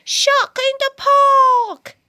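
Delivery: shrieked high and loud; shout. Acted. Boy spotting a shark, shouting out loud. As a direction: yell it out in alarm. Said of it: "He shouts".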